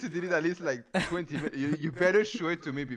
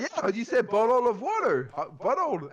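high, louder pushing question; exasperated high retelling; retold insistent question; 10 semitones higher, 4 dB louder than usual. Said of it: "I was like".

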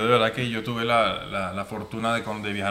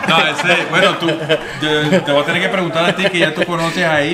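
real laughter, higher and louder, words breaking through giggles; laughing while talking; 4 semitones higher, 8 dB louder than usual. Real, not acted. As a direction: talk through helpless laughter. Laughing at friend's teasing.

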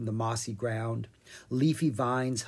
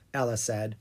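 usual speaking voice

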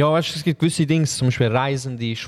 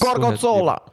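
excited exclaim; 10 semitones higher than usual. Pretend. Mock exclaiming cheese name.

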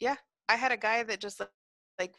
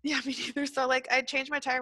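usual speaking voice

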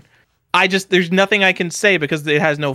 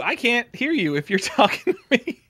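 ordinary talk

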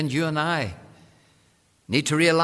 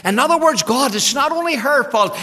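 preached shout; 11 semitones higher, 9 dB louder than usual. Retold or imitated. Preacher paraphrases Adam blaming God.

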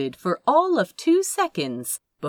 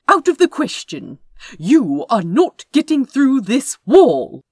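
higher, louder indignant hero; haughty hero; 6 semitones higher, 8 dB louder than usual. Acted. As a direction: refuse with lofty indignation. Haughty hero refuses loudly.

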